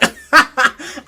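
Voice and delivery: loud burst of laughter; real laugh. Real, not acted. To her audience, a loud real laugh. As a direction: crack up at herself.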